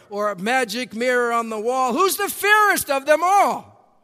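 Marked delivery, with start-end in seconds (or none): 1.9-4.0 high, loud, commanding queen; evil queen voice. Retold or imitated. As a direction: call out to the magic mirror. Fairy tale queen quoted.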